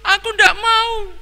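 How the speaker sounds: put-on very high sobbing boy; wailing child refusing. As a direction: refuse through tears like a child. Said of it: "sambil menangis semakin keras". (anak menolak sambil menangis keras)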